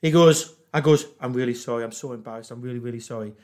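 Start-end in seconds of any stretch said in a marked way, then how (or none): 1.2-3.3 much softer, lower contrite murmur; sheepish apology. Retold or imitated. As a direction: mumble it, embarrassed and small. Drunk apologising to hotel cop.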